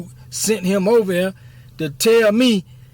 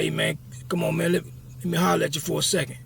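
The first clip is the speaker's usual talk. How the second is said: raspy voice; 5 dB quieter than usual. Retold or imitated. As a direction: rasp it out quietly, like a street older head. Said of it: "He had a real raspy voice".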